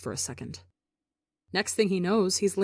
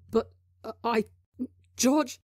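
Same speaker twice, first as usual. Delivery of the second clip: stammered, higher, wheezy plea; wheezing; 5 semitones higher than usual. Acted. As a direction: gasp out the name, rattled. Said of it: "Tubbo wheezes".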